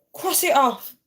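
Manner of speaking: high, loud mum's command; imitated mum. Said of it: "my mum would be like".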